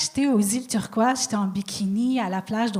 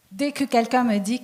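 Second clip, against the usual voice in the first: ordinary talk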